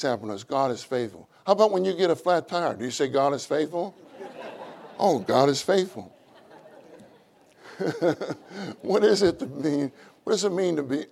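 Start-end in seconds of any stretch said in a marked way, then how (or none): none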